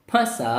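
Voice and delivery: फंसा with the first vowel sounded through nose and mouth; nasal chandrabindu vowel in फंसा; nasalised vowel. Shown as a demonstration. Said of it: "नाक और मुंह दोनों की सहायता से".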